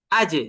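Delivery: আজে with a struck, echoing j; qalqalah. Shown as a demonstration. Teacher repeats the Jim example, j of আজে released with a jolt. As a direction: bounce the final j sound of আজে. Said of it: "জিমটাকে কিভাবে কলকলা করব".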